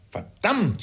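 high exasperated outburst; sharp rise in exasperation; cried curse. A narrator's reading. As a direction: cry out the curse, pitched high. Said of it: "schrie mein Vater".

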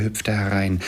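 ordinary delivery

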